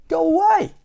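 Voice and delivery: very high, loud cartoon yell; Mister Grumpy. A narrator's reading. Grumpy man sends his neighbour off, yelling. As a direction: shout it in a squeal of temper. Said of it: "yelling at Mister Happy".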